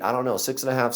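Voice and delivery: plain reading voice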